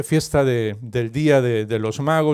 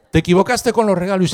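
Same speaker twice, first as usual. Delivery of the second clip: raised, louder scolding husband; mock scolding; 6 semitones higher, 5 dB louder than usual. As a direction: act out a husband telling off his wife. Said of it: "le echó una bronca a la mujer". (bronca fingida de marido)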